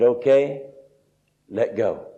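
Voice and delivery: low, quiet, matter-of-fact order; voice of God. Retold or imitated. God tells the dangling man to release the bush, calmly. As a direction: imitate God, calm and low. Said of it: "And God said".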